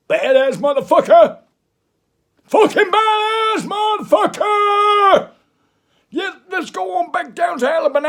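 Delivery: high, loud Southern yell, slow and stretched. Acted. Hyping up the listeners about Alabama, a high hollering shout. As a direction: holler it like a wild Alabama good old boy. Hyping wild American redneck voice.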